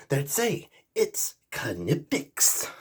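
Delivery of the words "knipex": Knipex drawn out slowly as kuh-NIP-ix; deliberate pronunciation. Shown as a demonstration. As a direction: hammer out each syllable of Knipex, K voiced. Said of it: "That's how they say it".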